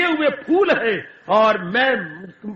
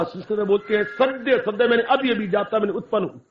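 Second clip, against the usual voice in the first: ordinary talk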